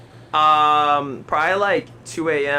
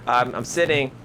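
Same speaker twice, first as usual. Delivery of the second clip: usual speaking voice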